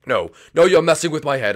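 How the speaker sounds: a touch of villainous panic; rattled villain. Acted. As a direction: refuse to be tricked, getting loud.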